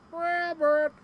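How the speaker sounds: high sing-song call of Rabbit; high teasing Tigger call. Retold or imitated. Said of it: "He's like".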